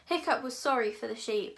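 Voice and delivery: ordinary delivery, nothing marked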